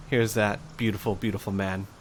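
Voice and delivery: usual speaking voice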